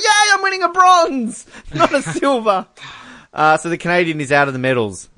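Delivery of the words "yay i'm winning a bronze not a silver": high, gleeful mock cheer; happy athlete imitation. Host voicing the New Zealander hugging, mock happy cheer. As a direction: mimic a thrilled medallist, far up in pitch. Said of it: "'cause he's happy. He's like".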